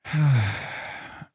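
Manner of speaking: long falling sigh; real exasperated sigh; heavy sigh. Real, not acted. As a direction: let out a long, frustrated sigh. Stream setup keeps failing.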